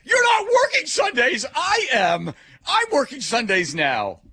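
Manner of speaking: put-on teen complaining about his new shifts; outraged yell. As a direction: shriek it then drag out the last bit. Outraged quote to Jim.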